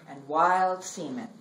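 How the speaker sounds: measured, expressive poem reading with long pauses; poetry reading. Acted. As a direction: read it like a poet on stage, savoring each image. Reads her poem aloud.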